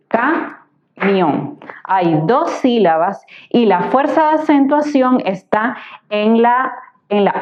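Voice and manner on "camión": "camión" split in two, ca, mión, with a clear gap; syllable by syllable. Shown as a demonstration. Teacher separating a word for students, "camión" syllable by syllable. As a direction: break "camión" slowly into ca... mión. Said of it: "la separamos en sílabas sería".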